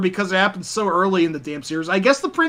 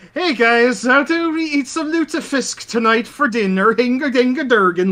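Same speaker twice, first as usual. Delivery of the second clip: goofy Nordic caricature, pitched up; Nordic accent; 6 semitones higher than usual. Retold or imitated. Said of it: "sounds just like the most, uh, stereotypical Nordic".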